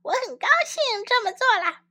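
high, quick, squeaky little mosquito. Acted. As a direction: chirp it like a tiny insect. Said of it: "蚊子说".

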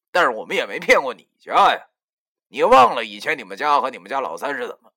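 cold sneer building into indignant scolding; sneering rebuke. A narrator's reading. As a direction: throw their hypocrisy back at them loudly. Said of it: "旁边林叔冷笑着说".

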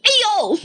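高声痛叫哎呦; 被摔倒时大叫; pained ouch. Acted. 高个子喊. (被压倒时痛叫)

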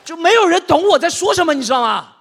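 high, very loud outburst; despairing comic yell; exasperated shout. Acted. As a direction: yell it out in total frustration. Exasperated nobody understands him.

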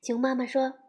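usual speaking voice

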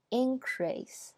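increase stressed on IN, first syllable up and falling; noun stress. Shown as a demonstration. Teacher repeats the noun, stress on IN. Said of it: "前面是名词".

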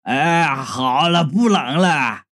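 low goofy simpleton voice; dopey relief. A narrator's reading. Simpleton stopping after running himself warm, dopey relief. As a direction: settle into low oafish contentment.

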